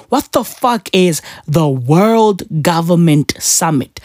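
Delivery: usual speaking voice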